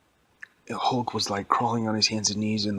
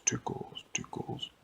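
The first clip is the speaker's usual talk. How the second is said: fading echo; 9 dB quieter than usual. Acted. Giant's voice echoing through the whole place, fading. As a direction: echo dying away to a whisper. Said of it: "his voice echoed through the whole place".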